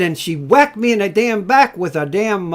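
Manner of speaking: ordinary talk, nothing marked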